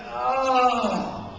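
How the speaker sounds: long drawn-out groan; weary groan. Acted. Student facing four books in two weeks, long groan. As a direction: groan it out, overwhelmed.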